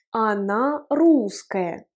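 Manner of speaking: Она русская spoken aloud, very slowly; slow, clear voiced model phrase; slow voiced demo. Shown as a demonstration. Teacher models a phrase, whispered then voiced.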